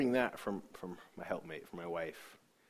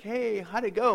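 high, loud cheery hello; raised-pitch welcoming voice; imitated wife's greeting; 11 semitones higher, 7 dB louder than usual. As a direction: imitate a wife asking brightly. Wife greeting him at home.

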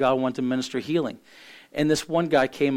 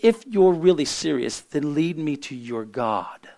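put-on South African accent; 2 semitones higher, slower than usual. South African woman challenges minister to lead her to God, in South African accent. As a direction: put on a near-British South African accent, stretching the ending. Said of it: "South Africans have a different accent".

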